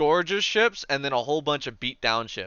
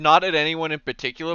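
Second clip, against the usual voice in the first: ordinary talk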